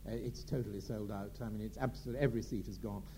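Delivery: a prim clerk turning a caller away; box office clerk. Retold or imitated. Box office clerk telling Coral the show is sold out, low and soft. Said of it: "And the box office said".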